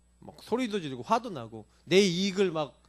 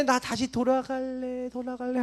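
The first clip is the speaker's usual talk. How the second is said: mock longing cry; 5 semitones higher, 4 dB quieter than usual. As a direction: stretch it out like a hushed far-away cry. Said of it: "하고 이렇게 돌아가죠".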